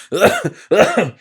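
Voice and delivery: harsh, drawn-out coughing; racking cough. A narrator's reading. Sick miser coughing while the stranger talks. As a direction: cough like it tears the chest.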